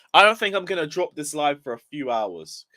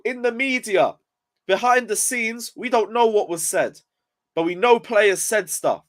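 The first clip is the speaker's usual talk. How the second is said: worked up; 7 semitones higher than usual. Real, not acted. Heated rant, pitch way up.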